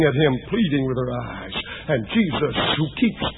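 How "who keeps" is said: ordinary talk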